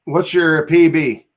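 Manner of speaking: slow, deliberate repeat; slow and clear. Real, not acted. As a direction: spell it out slowly so it gets through a bad connection. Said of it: "I didn't hear anything you just said".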